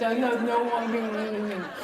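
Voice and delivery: slow, drawn-out dopey husband; mocking buck-toothed dimwit. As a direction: talk through buck teeth, dragging it out. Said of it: "they have buck teeth and they talk like this".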